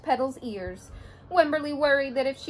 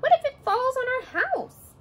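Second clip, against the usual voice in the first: put-on worried child; 12 semitones higher, 3 dB louder than usual. Wimberly's worried question.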